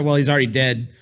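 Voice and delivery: ordinary talk, nothing marked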